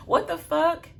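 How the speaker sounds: high, loud disbelieving exclaim; stunned outburst. Real, not acted. Telling viewers the husband's ending, in high loud disbelief. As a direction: blurt it out in shock.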